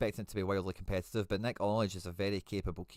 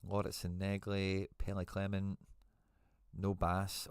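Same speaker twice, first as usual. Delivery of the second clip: normal talking voice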